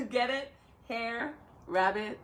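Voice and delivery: ordinary talk, nothing marked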